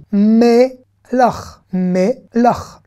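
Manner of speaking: "Melach" slowly as mé, then lach; a gap between the two syllables of "Melach". Shown as a demonstration. Teacher decoding the Hebrew word for salt, syllable by syllable. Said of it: "on va faire consonne plus voyelle".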